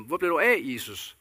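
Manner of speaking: shouting after Jesus where he was and why he came too late; calling out to Jesus. Pretend. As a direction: cry out after him, demanding an answer. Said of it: "råbe efter ham".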